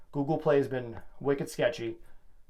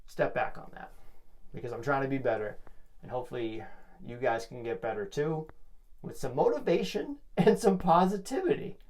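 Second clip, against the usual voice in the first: ordinary talk, nothing marked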